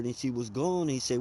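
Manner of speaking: usual speaking voice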